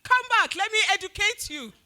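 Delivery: high, loud yell; yelling after him. Retold or imitated. Yelling after the man leaving the shop, loud and high. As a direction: shout him back as he walks out. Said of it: "I was yelling after him".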